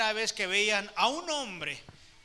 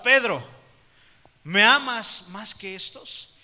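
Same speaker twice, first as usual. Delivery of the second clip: much louder, slow and pressing; louder pressing question; 10 dB louder, slower than usual. Acted. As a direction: press him slowly and loudly for an answer. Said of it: "El Señor le pregunta". (pregunta insistente, más fuerte y lenta)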